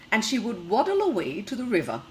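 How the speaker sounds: lively storybook reading for little ones; reading to children. Acted. Teacher reading a picture book to the class, lively storytelling. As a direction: read the tale brightly to the class.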